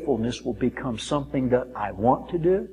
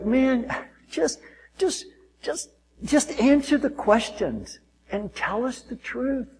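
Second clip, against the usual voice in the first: lightly acted pleading, pitched higher and softer; mock pleading; 9 semitones higher, 5 dB quieter than usual. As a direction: coax him, half pleading. Pastor pleading with counselee.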